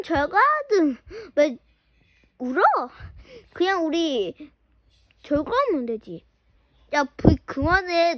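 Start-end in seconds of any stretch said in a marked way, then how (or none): none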